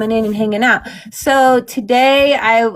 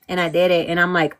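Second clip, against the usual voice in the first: ordinary talk, nothing marked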